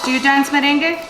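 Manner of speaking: usual speaking voice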